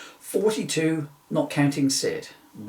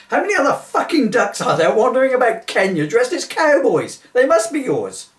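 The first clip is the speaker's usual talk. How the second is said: exasperated outburst; 8 semitones higher, 7 dB louder than usual. Acted. Exasperated policeman blows up.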